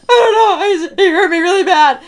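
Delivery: high, loud, laughing whimper; laughing, tearful wail. Retold or imitated. Girl helplessly wails her answer to grandpa.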